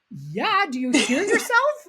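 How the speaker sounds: high, loud, cracking up on the comeback; retold incredulous laughing. Teenager laughing at a guy's Australian-accented Greek, incredulous and amused. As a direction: throw it back at him, bursting with laughter.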